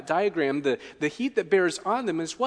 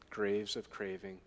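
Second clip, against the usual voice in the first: soft echo; 7 semitones lower, 12 dB quieter than usual. Shown as a demonstration. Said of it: "It means graves of craving".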